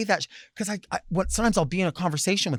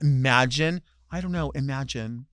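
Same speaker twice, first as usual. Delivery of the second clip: usual speaking voice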